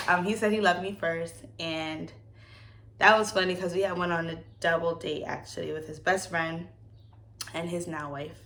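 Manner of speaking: ordinary talk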